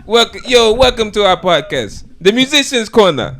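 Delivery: loud, high, slow mock Jamaican accent; hammed-up Jamaican podcast host. Shown as a demonstration. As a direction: welcome listeners in a booming Jamaican accent. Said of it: "The Jamaican accent?".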